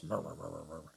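low, muttered murmur of a crowd; mock murmuring. Mimicking the elders whispering among themselves, low murmur. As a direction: grumble it under your breath. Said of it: "They talked amongst themselves".

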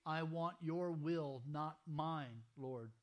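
slow, deliberate mantra. Shown as a demonstration. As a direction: spell out each word slowly. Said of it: "This is your mantra".

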